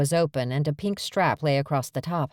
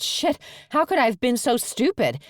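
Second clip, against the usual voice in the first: panicked; 8 semitones higher than usual. A narrator's reading. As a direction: voice jumps up in alarm. Panicked realisation after whispering.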